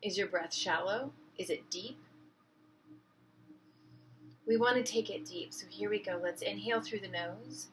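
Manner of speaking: soft, unhurried meditation voice with long pauses; hushed, calming breathwork instruction; guided meditation. Real, not acted. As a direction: gently guide listeners into their breath.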